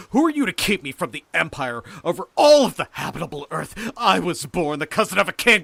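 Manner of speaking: loud, high, megalomaniac rant; full-voiced imperious tirade; ranting shout. A narrator's reading. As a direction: rave at a rival for the throne. Mad rant claiming the empire.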